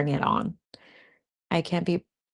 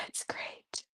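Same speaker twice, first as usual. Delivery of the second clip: breathy whispered "great"; 19 dB quieter than usual. Real, not acted. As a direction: whisper it to yourself. Soft afterthought.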